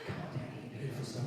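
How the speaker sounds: soft, hushed stage whisper. Real, not acted. Host beckoning while whispering to the audience, hushed whisper. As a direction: whisper it close to the microphone. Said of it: "We're whispering to the audience".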